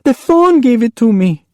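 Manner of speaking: put-on high, tearful child's plea; girl sobbing, defending herself. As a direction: sob it out. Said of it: "Ophelia sobbed".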